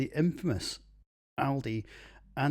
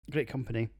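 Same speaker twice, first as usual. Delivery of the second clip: ordinary talk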